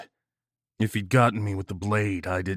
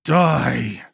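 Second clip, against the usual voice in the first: loud, raised defiant cry; furious roar; 4 semitones higher, 6 dB louder than usual. A narrator's reading. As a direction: roar the word out in fury. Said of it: "still roaring in fury".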